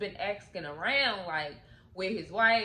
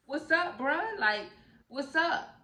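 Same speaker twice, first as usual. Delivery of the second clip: higher-pitched acted greeting; surprised greeting; 7 semitones higher, 3 dB louder than usual. Mac opens the door to his best friend, high surprised greeting. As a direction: greet him on a raised, lifted voice.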